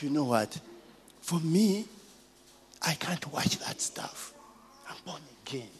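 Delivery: soft breathy whisper, fading out; half-whispered confession of faith. Retold or imitated. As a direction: lean in and whisper it. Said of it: "Beat him a whisper".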